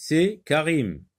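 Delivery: c'est Karim said high with a falling answer tune; teacher modelling the answer c'est. Shown as a demonstration. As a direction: answer it clearly for pupils to repeat. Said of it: "on répond par c'est".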